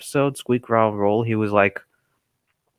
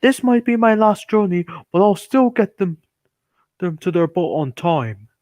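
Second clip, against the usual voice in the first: proud Gordon; 6 semitones higher, 3 dB louder than usual. Retold or imitated. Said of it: "This made Gordon proud".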